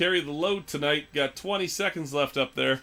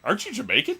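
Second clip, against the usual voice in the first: mock Triple H; 8 semitones higher, faster than usual. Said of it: "And Triple H is like".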